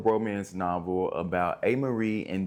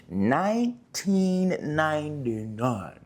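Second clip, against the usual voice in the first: slow, higher drawn-out repeat of the year; dramatic echo; 7 semitones higher, much slower than usual. Pretend. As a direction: drag out "Nineteen ninety-nine" like a movie trailer. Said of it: "repeats year dramatically".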